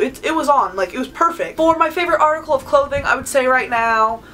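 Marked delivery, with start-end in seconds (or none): none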